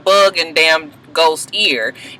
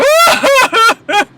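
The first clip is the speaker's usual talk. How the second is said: high-pitched mock wail breaking into laughter; mock crying; 4 dB louder than usual. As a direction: imitate her sobbing, hammed-up and very high. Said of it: "you can hear her just crying".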